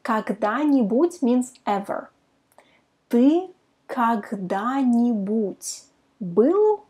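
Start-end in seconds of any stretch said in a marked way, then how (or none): 3.1-6.8 slow, word by word; slow demo. Shown as a demonstration. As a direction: spell it out slowly, each word apart. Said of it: "try to pronounce it fast".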